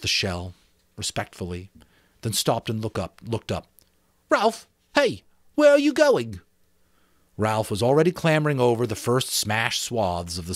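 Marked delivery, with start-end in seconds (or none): 4.3-6.4 calling after. Acted. Piggy calls after Ralph.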